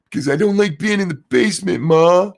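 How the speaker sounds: imitating a sulky kid complaining to his mom; whiny son.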